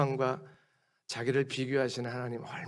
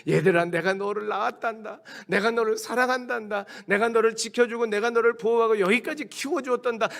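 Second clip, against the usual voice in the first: higher, louder pleading parent; begging parents; 8 semitones higher, 5 dB louder than usual. Retold or imitated. Parents pleading with their children, hammed-up begging. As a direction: coax the kids as a begging parent. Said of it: "막 애걸 복고를 하면서 설득합니다".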